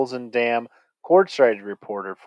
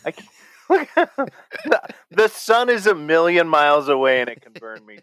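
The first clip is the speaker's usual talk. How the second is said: laughing; 4 semitones higher than usual. Real, not acted. Joking about sunburn.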